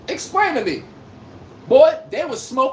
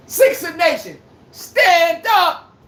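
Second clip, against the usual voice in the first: raised, higher hype shout; rallying call; 5 semitones higher, 3 dB louder, much slower than usual. Real, not acted. Host rousing Sixer Nation after Boston loses, rallying cry. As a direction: call the fans to their feet.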